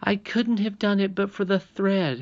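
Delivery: high princess voice, insisting; insistent. A narrator's reading. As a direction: hold your ground firmly. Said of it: "insisted Irene".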